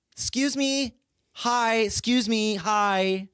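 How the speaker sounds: high insistent mock call; calling lady. Mocking the lady calling out in the park, high. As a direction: pipe it high and persistent.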